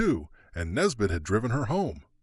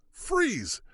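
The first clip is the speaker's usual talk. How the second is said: a high hollered order; 13 semitones higher than usual. A narrator's reading. Marshal orders the clerk to freeze, hollered. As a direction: holler the one word. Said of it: "the other marshal hollered".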